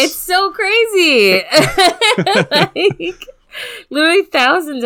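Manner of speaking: squealing high through laughter; laughing so hard it nearly turns to crying. Real, not acted. Co-host laughs with joy at the listener count, laughing. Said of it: "laugh-crying at sixty thousand listens".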